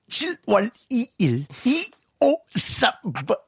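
slow, stiff machine-like reading; robot voice. Pretend. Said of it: "기계처럼 읽을 거예요". (기계처럼 딱딱하게 천천히)

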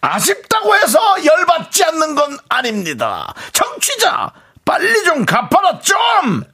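angry shout. Acted. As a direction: rant at the debtor. Angry rant segment opening. (높고 크게 화내며 외치기)

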